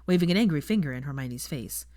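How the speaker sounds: ordinary delivery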